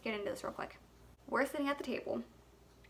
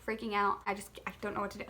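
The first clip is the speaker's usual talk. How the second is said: normal talking voice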